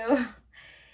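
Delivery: loud nervous fake laugh; a loud awkward chuckle. Awkward laugh after getting caught.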